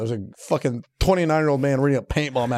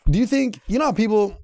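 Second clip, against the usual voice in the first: ordinary delivery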